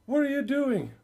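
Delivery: alarmed at what his daughter did; shocked, alarmed father. Acted. Father finds eyes plucked out.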